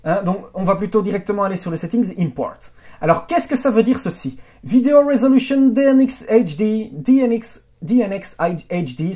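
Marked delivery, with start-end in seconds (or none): 4.6-9.1 attempted English accent, higher pitch; French speaker reaching for English pronunciation; faltering English accent. Real, not acted. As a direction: stumble through the letters in an English accent. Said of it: "sorry, je perds mon accent anglais".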